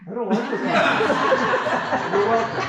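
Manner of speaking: amused reaction to the audience; laughing outburst. Real, not acted. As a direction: burst out laughing while reading comments.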